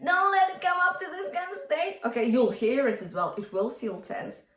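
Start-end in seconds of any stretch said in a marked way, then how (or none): 0.0-2.0 high, tight raised-larynx voice. Shown as a demonstration. Coach warns against a tense larynx, high raised-larynx voice. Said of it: "keep your larynx relaxed".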